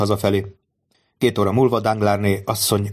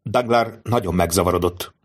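ordinary delivery, nothing marked

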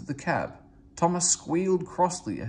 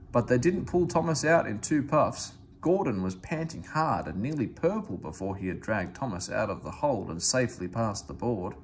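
ordinary delivery

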